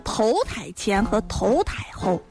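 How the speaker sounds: ordinary delivery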